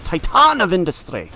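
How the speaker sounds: loud, high burst of alarm; startled exclamation. Real, not acted. Player reacting to the opponent's threatening new card, startled exclamation.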